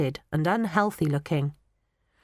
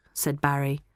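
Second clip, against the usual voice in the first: ordinary delivery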